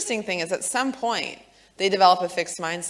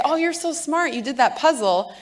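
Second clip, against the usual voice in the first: high gushing praise; mock gushing parent praise; 7 semitones higher than usual. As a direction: gush over the child. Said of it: "if I just say".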